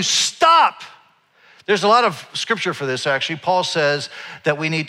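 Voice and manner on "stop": sudden high, emphatic command; commanding stop sign. Acted. Stop sign warning someone as anger rises, commanding. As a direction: call it out high like an urgent order. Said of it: "And it's telling you".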